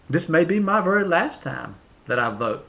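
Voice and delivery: normal talking voice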